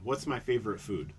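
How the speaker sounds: usual speaking voice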